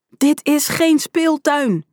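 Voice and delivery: high, drawn-out roar; angry bellow. A narrator's reading. Uniformed man storms at the kids, bellowing. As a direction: shout it slowly as a scolding. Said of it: "brulde de man".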